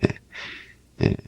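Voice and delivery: ordinary delivery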